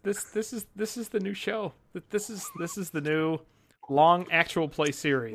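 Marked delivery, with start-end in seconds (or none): 0.0-3.4 hushed aside. Real, not acted. Quiet aside to audience.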